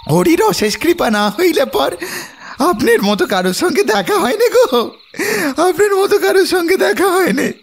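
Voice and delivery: high, wailing village boatman; sobbing, pleading praise in rustic dialect; tearful gratitude. Acted. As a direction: overcome with thanks, crying the words out. Boatman's tearful thanks to rescuer.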